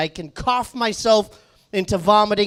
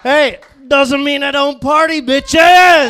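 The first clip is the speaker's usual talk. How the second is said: loud, high crowd-rallying yell; boisterous boast, stretched last word; rowdy party shout; 5 semitones higher, 8 dB louder, much slower than usual. Real, not acted. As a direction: build to a long drawn-out holler. Comic rallies the crowd.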